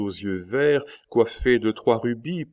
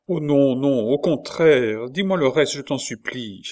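higher, louder urgent plea; pleading; 5 semitones higher, 5 dB louder than usual. A narrator's reading. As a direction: beg her to go on. Marguerite begs to hear more.

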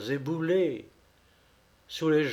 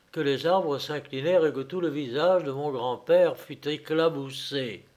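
usual speaking voice

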